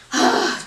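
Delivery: acted breathy sigh of release; exasperated sigh. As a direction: sigh it out to God. Acts out venting to God.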